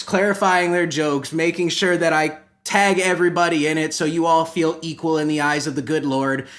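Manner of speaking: ordinary talk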